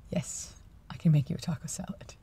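hushed whisper. Real, not acted. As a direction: whisper it to yourself. Whispered aside.

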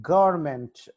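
Government with a loud first syllable; government, strong start, falling end; stress at the G. Shown as a demonstration. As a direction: punch "gov" in government. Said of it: "జి దగ్గర".

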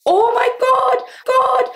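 very high, loud wail; Chewbacca-like outburst. Real, not acted. Vlogger exclaiming to herself, sounding like Chewbacca. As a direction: shout it out in a high, drawn-out cry. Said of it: "Why did I sound like Chewbacca".

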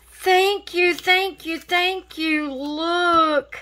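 high repeated thank you; excited thanks. Real, not acted. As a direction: gush your thanks up high. Thanking friend for gifts.

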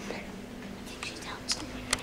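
hushed whisper so she can't hear; whispering while hiding. Real, not acted. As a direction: whisper it, sneaking up. Hiding game, sneaking around.

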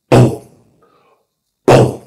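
loud, sudden single shots; explosive vocal bang; imitated gunshots. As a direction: punch out each shot, well apart.

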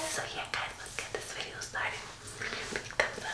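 secretive, hushed whisper. Real, not acted. Vlogger whispers to viewers before the prank, whispering. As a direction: whisper it so he won't overhear.

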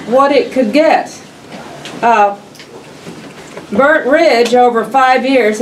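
normal talking voice

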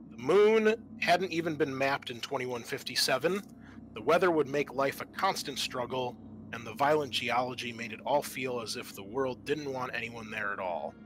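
normal talking voice

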